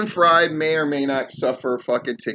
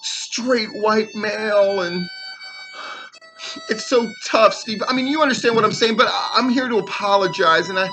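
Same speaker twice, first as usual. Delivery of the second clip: higher, put-on mock apology voice; mocking Jacob Ashworth; 4 semitones higher than usual. Speaker mocks Jacob Ashworth apologizing to Steve, lightly voiced whine. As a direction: parody his whiny fake apology. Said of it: "if I had called him, I'm sure this is what I'd heard".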